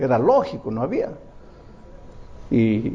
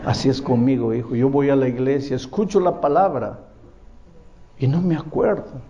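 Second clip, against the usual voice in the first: gentle grandmother; 6 semitones lower than usual. Retold or imitated. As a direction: explain it gently to the boy. Grandmother continues her lesson.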